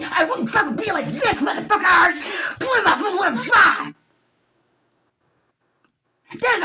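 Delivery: high, loud screaming rage, voice cracking; yelling curses at the doctor who lied; furious shout. Real, not acted. Ranting patient screams furiously at the lying doctor.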